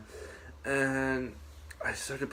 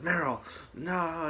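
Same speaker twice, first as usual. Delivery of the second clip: high, drawn-out mock anguish; slow, stretched-out retold outcry; Snake; 7 semitones higher, much slower than usual. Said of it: "Snake would get mad".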